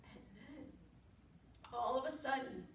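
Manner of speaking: usual speaking voice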